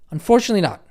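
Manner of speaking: ordinary delivery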